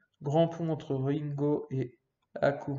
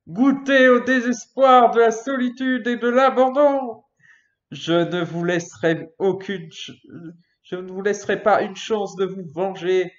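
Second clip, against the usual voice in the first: loud, higher threatening declaration; menacing villain; 6 semitones higher, 11 dB louder than usual. Acted. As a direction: curse them with full force. Villain curse read aloud.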